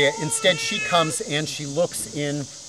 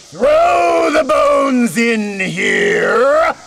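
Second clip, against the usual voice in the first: very slow, loud, higher command; commanding shout; 10 semitones higher, 8 dB louder, much slower than usual. Acted. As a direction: draw it out as a booming order. Berchta orders the guests.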